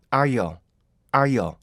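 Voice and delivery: "아이어" in three clear beats, 아-이-어. Shown as a demonstration. Teacher showing how aisle should sound. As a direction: give "아이어" three separate pulses. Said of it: "원래 이렇게 읽어야 정상이고요".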